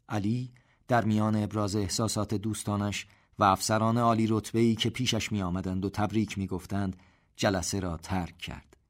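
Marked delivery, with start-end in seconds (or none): none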